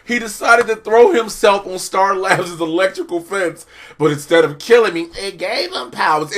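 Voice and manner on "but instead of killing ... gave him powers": usual speaking voice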